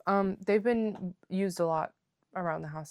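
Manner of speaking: ordinary delivery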